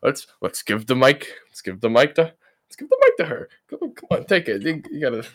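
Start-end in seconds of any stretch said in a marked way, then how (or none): 2.2-5.2 high, squeaky, teasing. Acted. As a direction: jump way up into a squeaky pitch. Handing over the mic playfully.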